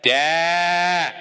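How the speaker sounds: long, loud imitated bleat of a yell; toddler scream. Father mimics son screaming from the crib, drawn-out toddler scream. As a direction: hold it out, loud like a demanding toddler. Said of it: "he starts screaming".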